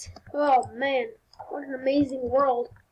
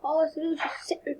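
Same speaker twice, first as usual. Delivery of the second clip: usual speaking voice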